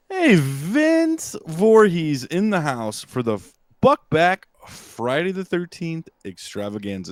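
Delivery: slow, high, loud show intro; announcer voice. Acted. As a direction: hype it like a big event host.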